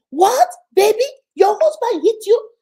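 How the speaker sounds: high, loud disbelieving cry; shocked outcry. Retold or imitated. Brother hearing his sister was hit, loud shock. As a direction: react as if struck by the news.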